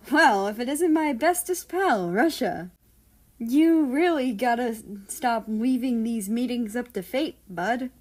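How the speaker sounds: excited, mock-sweet hello to a friend; teasing greeting. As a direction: greet your bestest pal with a cheeky grin. America teases his friend.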